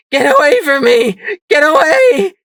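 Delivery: very high, very loud terrified scream. Acted. Sunflower fighting off the ghoul, screaming in terror. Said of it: "she screamed".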